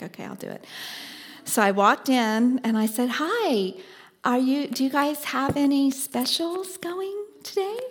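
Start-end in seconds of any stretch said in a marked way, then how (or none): 0.0-0.6 much lower and softer, defeated; reluctant giving in. Retold or imitated. Giving in to her coach, low defeated mumble. 3.2-7.8 higher, sugary hopeful voice; sweet hopeful ask. Retold or imitated. Asking the hotel clerk for specials, higher sugary voice.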